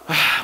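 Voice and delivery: big audible exhale; sigh of relief. Shown as a demonstration. Preacher showing the relief God gives, sigh of relief. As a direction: let out a relieved breath. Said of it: "un respiro".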